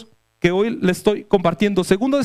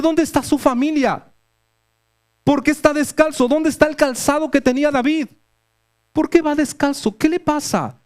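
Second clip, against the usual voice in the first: preguntas retóricas más agudas, con asombro; emotive preaching; 4 semitones higher than usual. Acted. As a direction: predicar con asombro creciente sobre David. Preacher's emotive rhetorical questions. (predicación apasionada)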